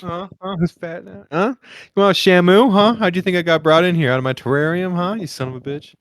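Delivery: high, hammed-up taunting voice; mock taunt. Guy taunting the stranger who called him big, mock gloating. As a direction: goad him like a sore winner.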